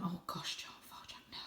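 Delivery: hushed, fretful "oh gosh"; whispered worried aside. Real, not acted. Reader fears for Varjak, whispering softly. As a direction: whisper a warning under your breath.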